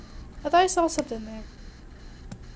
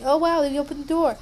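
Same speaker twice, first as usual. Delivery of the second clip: ordinary delivery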